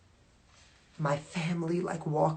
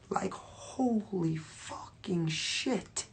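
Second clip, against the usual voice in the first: slow, choked-up whisper, much softer; tearful whisper; 2 semitones higher, 7 dB quieter, much slower than usual. Real, not acted. Picturing herself grabbing the microphone, a slow tearful whisper. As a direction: whisper it as if about to cry. Overwhelmed picturing Super Bowl moment.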